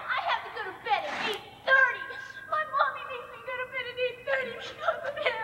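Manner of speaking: put-on fast whiny child voice. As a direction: complain like a sulking kid. Childish complaint.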